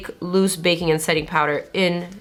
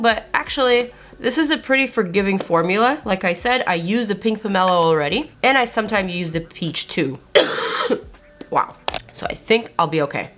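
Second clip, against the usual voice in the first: ordinary talk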